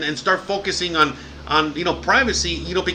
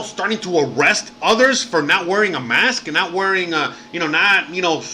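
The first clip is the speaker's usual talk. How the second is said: heated rant; 3 semitones higher, 3 dB louder than usual. Retold or imitated. Leader blasting the country for releasing criminals, angry and loud. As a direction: hammer each why like an outraged leader. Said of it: "He's, like, hitting it hard".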